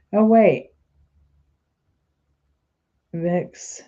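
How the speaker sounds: usual speaking voice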